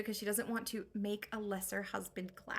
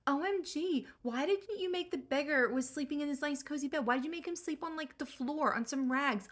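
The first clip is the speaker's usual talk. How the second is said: put-on whiny teen; 2 semitones higher than usual. Telemachus scolding his elderly nanny, in a whiny teen voice. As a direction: whine like a spoiled teen.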